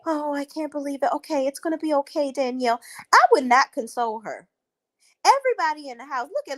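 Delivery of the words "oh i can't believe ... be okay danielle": high, fake-sympathetic cooing; mock her consoling, pitched up; imitating a fake friend's comforting; mocking Maya.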